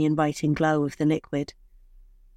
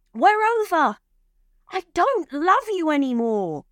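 high-pitched, raised breakup outburst; angry outburst; 12 semitones higher, 4 dB louder than usual. A narrator's reading. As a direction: throw it at them in a high, heated voice. He furiously ends the relationship.

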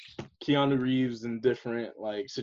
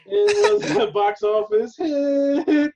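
high, slow, laughing through words; 10 semitones higher, 4 dB louder, slower than usual. Real, not acted. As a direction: crack up mid-sentence. Laughing through mock sermon.

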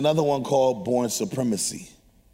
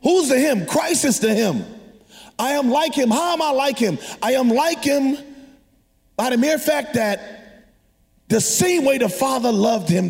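preaching; 9 semitones higher than usual. Acted. Preaching call and response.

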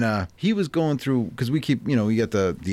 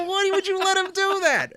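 much higher, laughing half-angry demand; retold angry question. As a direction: scold the daughter in mock outrage. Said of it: "getting mad".

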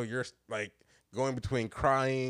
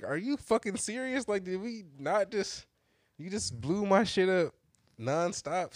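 higher-pitched, incredulous outburst without shouting; retold indignation; 8 semitones higher than usual. Retold angry call to girlfriend.

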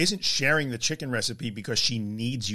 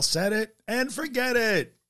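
much higher, slow infomercial pitchman voice; Ron Popeil pitch; 10 semitones higher, slower than usual. Retold or imitated. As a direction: sell it like a late-night TV ad. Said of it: "We all have a little Ron Popeil in us".

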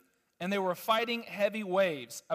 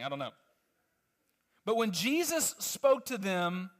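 ordinary talk, nothing marked

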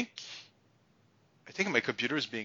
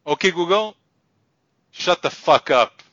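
loud command; 7 semitones higher, 10 dB louder than usual. Real, not acted. Yelling at voice assistant.

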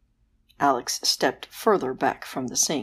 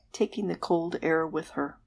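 ordinary delivery, nothing marked

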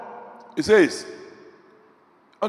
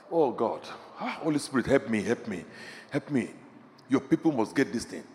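quiet prayer; 8 semitones lower, 10 dB quieter than usual. Real, not acted. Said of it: "prays aloud quietly".

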